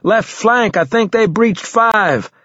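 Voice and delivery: high, urgent battlefield shout; shouted warning. A narrator's reading. Soldier warning squad of a breached bunker, shouting. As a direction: shout the alarm, drawing out the end. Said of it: "I shouted".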